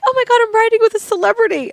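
high, rushed, starstruck squeal; gushing fan. Retold or imitated. Imitating a woman riding with a celebrity, gushing. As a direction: gush it fast and high. Voices thrilled fan on train.

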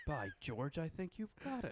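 high, delighted mock British; British accent. Host praising the guest's elocution, high mock British accent. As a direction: exclaim it as a pleased British tutor.

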